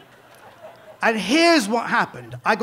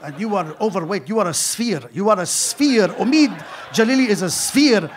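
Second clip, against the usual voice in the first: Omar Sharif impression; 3 semitones lower than usual. Omar Sharif mocking the comic's weight, Egyptian accent rising to a shout. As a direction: scold him about eating in a light Egyptian accent. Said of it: "he's a very humorless man".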